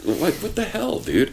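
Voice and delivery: mock exasperation. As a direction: act baffled at the clingy dog. Talking to the clingy dog.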